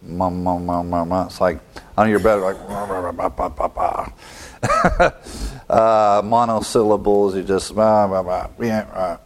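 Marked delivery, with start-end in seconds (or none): 0.0-1.3 low, soft mum mum mumbling; soft low murmuring sounds. Shown as a demonstration. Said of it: "Murmur is onomatopoetic". 7.7-8.9 muttered bah bah; grumbling bah. Shown as a demonstration. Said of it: "utter with a low, low tone of voice".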